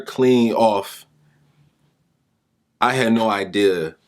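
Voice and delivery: normal talking voice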